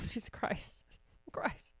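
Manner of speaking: whispered, exasperated aside. Real, not acted. Scolding herself for forgetting the audio listeners, exasperated whisper. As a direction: let it out under your breath.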